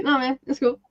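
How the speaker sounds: soft, laid-back Australian accent; very Aussie. Shown as a demonstration. Showing a friend Aussie sayings, laid-back Australian accent. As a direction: toss it off like a casual Australian. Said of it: "That was pretty Aussie".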